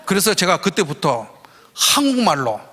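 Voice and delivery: normal talking voice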